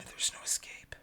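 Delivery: hushed, ominous whisper; mock sinister whisper; spooky whisper. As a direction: whisper it like a trap snapping shut. Joking menace.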